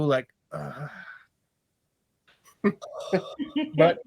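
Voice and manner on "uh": a quiet, low sighing uh. Acted. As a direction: let out a soft weary groan. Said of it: "just like a low".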